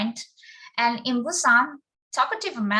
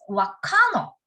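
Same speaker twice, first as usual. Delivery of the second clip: high-pitched Busan-dialect example; 11 semitones higher, 4 dB louder than usual. Shown as a demonstration. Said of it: "And another example is".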